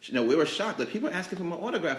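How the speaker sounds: normal talking voice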